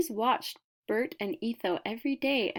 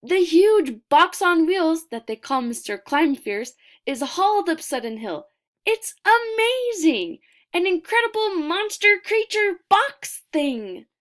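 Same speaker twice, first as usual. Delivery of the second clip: much higher and louder, bursting with wonder; breathless delight, higher and louder throughout; wild excitement; 7 semitones higher, 8 dB louder than usual. A narrator's reading. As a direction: read it like the best thing a child ever saw. The amazing box on wheels.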